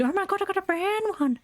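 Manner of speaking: very high, thrilled squeal; excited squeal. Real, not acted. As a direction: squeal with delight at a new find. Finding a brown chicken.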